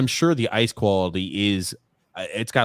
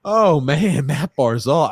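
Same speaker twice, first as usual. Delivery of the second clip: loud, high, laughing amazement; excited marvelling; 6 semitones higher, 6 dB louder, much slower than usual. Real, not acted. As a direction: still buzzing from the play. Still marvelling at Barzal's move.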